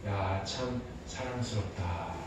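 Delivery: low, soft and slow loving murmur; tender delight. Retold or imitated. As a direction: savour each word with love. God delighting in humans. (사람을 보고 사랑스러워하시는 하나님의 느린 속삭임)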